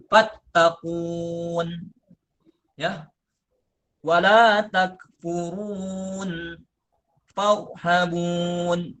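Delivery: fattaqun, takfurun, farhabun drawn out slowly; slow recitation. Shown as a demonstration. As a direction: recite each word stretched, dropping the ya. (dibaca pelan, ya digugurkan)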